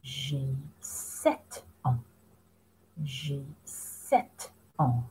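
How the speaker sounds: slow, soft and carefully spaced; slow model answer. Shown as a demonstration. Teacher modelling the child's answer to pupils, slow and soft. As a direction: spell each word out slowly for beginners. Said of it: "What is he going to answer?".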